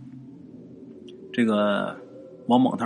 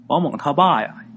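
plain reading voice

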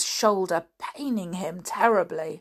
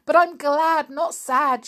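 tearful joy; 9 semitones higher, 6 dB louder than usual. Acted. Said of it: "she said".

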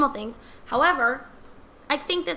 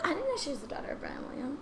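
fast whispered aside; 3 semitones higher, 9 dB quieter, faster than usual. Real, not acted. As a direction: whisper it to the side.

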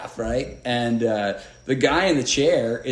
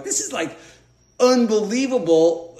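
disbelief; 7 semitones higher than usual. Retold or imitated. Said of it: "I'm like".

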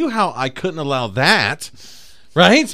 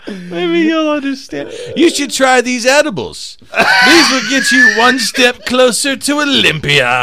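slow, high, loud guru voice; hammed-up prophet; 8 semitones higher, 4 dB louder, slower than usual. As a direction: preach it like a cult guru. Continuing the sage bit.

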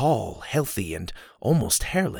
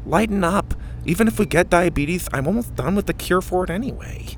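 put-on higher lisping kid voice; Mac's voice; 8 semitones higher than usual. A narrator's reading. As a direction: keep Mac's lisp going.

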